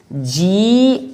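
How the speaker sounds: slow letter-by-letter जीआईआरएल; spelling out G-I-R-L. Shown as a demonstration. Teacher spelling a word for students, slow and clear. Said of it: "जैसे देख लीजिए".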